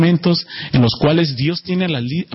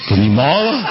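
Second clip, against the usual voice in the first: laughing; 4 dB louder than usual. Real, not acted. Giving in to the hug.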